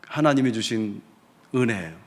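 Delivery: normal talking voice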